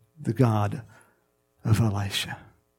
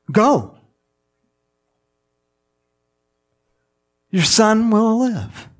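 higher, louder pronouncement as Jesus; commanding quote; 7 semitones higher, 5 dB louder, slower than usual. Acted. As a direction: declare it slowly and loudly, like a command. Voices Jesus' abrupt command.

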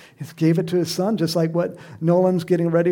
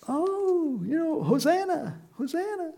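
mock crowd shout; 13 semitones higher than usual. Preacher imitating the crowd hailing the colt's rider, high mock shout. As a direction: call out in a high, held-back voice. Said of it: "shouting out".

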